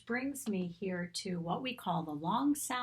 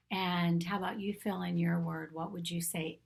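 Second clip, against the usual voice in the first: normal talking voice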